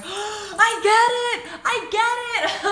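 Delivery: hammed-up gasping revelation; ecstatic squeal. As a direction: stretch it out in high delight. Gasping while watching a video.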